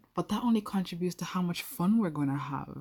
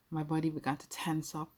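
ordinary delivery

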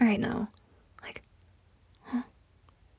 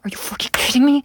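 angry outburst; 4 semitones higher, 6 dB louder, faster than usual. Acted. Outburst when he chickens out.

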